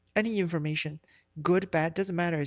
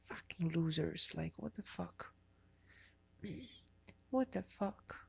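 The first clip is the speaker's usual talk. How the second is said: annoyed muttering; 11 dB quieter than usual. Real, not acted.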